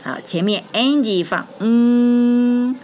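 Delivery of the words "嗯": 嗯 as a long, high ng hum; sustained ng sound; back nasal. Shown as a demonstration. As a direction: hold 嗯 steady in the back of the nose. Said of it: "是后鼻音".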